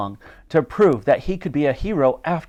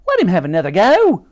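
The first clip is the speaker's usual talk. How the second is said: high, loud plea; pleading; 7 semitones higher, 6 dB louder than usual. A narrator's reading. Dottie begs the Admiral for Titch, pleading. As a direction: plead hard on his behalf. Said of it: "pleaded Dottie".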